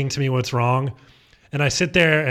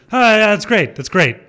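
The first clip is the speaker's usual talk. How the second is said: higher, louder fake delight; sarcastic; 8 semitones higher, 7 dB louder than usual. Answering the clerk's insult with mock, louder delight. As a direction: gush it sarcastically.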